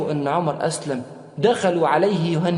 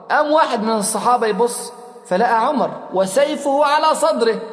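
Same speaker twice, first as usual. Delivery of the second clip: ordinary delivery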